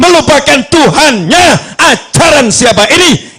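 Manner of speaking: high, ringing sermon declamation; full-force preaching. Acted. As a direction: hammer out each phrase in rhythm.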